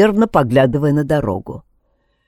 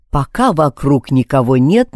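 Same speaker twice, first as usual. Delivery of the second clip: ordinary delivery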